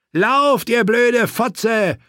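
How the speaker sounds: yelled high and loud, drawn out; screaming. A narrator's reading. Knight screaming at captive to flee and warn the king. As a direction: scream at her to run. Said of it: "schrie Sir Clayton".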